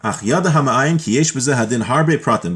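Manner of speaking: ordinary talk, nothing marked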